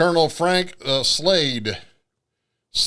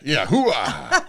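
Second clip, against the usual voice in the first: loud gung-ho soldier; 3 semitones lower than usual. Retold or imitated. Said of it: "if you would have said that".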